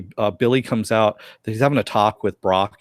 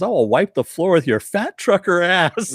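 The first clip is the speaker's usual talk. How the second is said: higher-pitched mock threat; mock angry threat; 7 semitones higher than usual. Councilwoman going off on her rival, mock angry threat. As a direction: go off on her in a put-on rant. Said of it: "she goes off on her".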